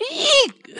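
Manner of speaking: very high, loud startled eek; frightened squeak. Acted. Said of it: "squeaked Tortoise with affright".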